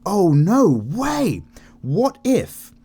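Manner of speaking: high, slow, drawn-out amazement; amazed gasp. Retold or imitated. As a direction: stretch it out, stunned by the idea. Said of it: "I was just like".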